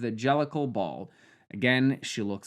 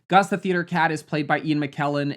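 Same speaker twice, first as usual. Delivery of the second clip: ordinary talk, nothing marked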